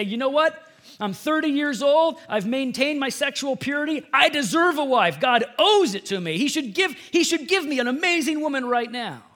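high, loud, indignant demand; entitled outburst. Retold or imitated. Preacher imitating a single man who feels owed a wife, entitled outburst. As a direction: imitate a man loudly insisting on his due.

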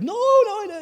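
very high, loud whiny no; boy protesting. Retold or imitated. Older brother denying, high loud childish wail. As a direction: wail the no like a wronged kid. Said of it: "Eli's like".

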